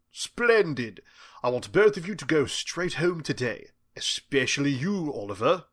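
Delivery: put-on British accent. A narrator's reading. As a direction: send them off in polished British English. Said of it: "his flawless British accent".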